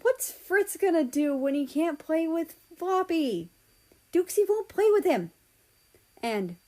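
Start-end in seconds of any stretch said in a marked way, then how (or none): 0.0-5.3 high, quick childish mimicry of a friend; mimicking a pouting friend's high whine; mock whiny Poland. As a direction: squeak it out like a pouting kid. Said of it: "he was saying things like".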